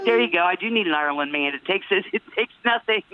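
ordinary delivery

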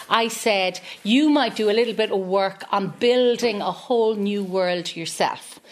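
usual speaking voice

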